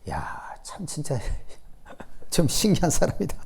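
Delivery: whispered wonder; hushed amused wonder; marvelling whisper. Real, not acted. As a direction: breathe it out in disbelief. Marvelling at Jonah.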